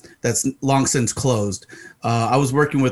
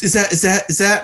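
flustered stammer; 8 semitones higher than usual. Retold or imitated.

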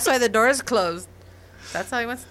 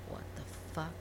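muttered under breath; 5 semitones lower, 17 dB quieter, much faster than usual. Retold or imitated. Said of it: "and you're like".

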